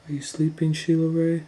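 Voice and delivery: put-on high sing-song taunt to a sleeping girl; lilting creepy whisper-call; teasing spooky call.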